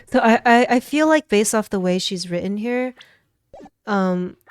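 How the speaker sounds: usual speaking voice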